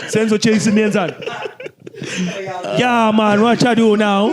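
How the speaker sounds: loud playful Jamaican patois; Jamaican accent. Retold or imitated. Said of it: "Do you understand in Jamaica?".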